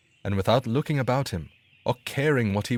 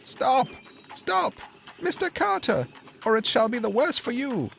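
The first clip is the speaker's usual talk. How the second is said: calling out. A narrator's reading. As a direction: shout to stop the cart. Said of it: "called out".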